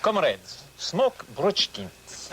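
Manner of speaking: Russian TV ad. Shown as a demonstration. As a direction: sell cigarettes like a Soviet announcer. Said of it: "Even in Russia".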